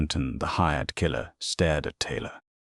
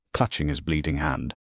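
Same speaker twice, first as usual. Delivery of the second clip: ordinary delivery, nothing marked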